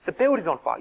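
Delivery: normal talking voice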